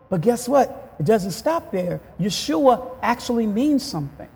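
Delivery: ordinary talk, nothing marked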